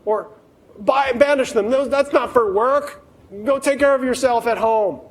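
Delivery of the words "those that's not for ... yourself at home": dismissive manager. Retold or imitated. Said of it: "so they say".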